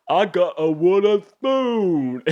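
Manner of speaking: loud, high, drawn-out taunt; put-on jeering voice, high and slow; mocking bully impression. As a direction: stretch it out like a name-calling bully. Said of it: "and they're like".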